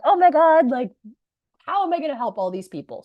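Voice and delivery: high loud gasp, then a rushed worried question; mock panic. Imitating her past self panicking over her students, high and rushed. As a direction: play the overwhelmed coach in panic. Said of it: "being like".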